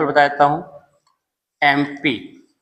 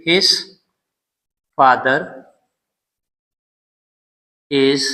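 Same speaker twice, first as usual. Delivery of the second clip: slow pauses, "an M L A" drawn out letter by letter; dictated word by word; much slower than usual. Shown as a demonstration. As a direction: say "his father is an M L A" as slow dictation. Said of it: "तो हम क्या लिखेंगे". (रुक-रुक कर लिखवाना)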